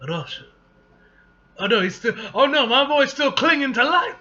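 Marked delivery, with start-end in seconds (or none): 1.6-4.1 alarmed fan reaction. Real, not acted. Fan reacting to the page, loud alarmed excitement. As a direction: cry out in worried delight. Reacts to character nearly dying.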